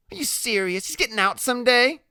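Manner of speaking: very high-pitched disbelief; incredulous Loretta. Acted. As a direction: question it in a high character voice.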